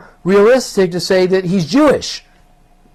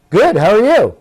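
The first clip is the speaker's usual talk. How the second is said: friendly reply; 6 semitones higher, 4 dB louder than usual. Retold or imitated. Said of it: "I'll ad-lib for you".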